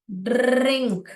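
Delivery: ड्रिंक with a long rolled r; ड्रिंक as drrrr-ink, in one slow breath; drawn-out R. Shown as a demonstration. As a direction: roll and stretch the r in ड्रिंक. Teacher demonstrates the word's sounds.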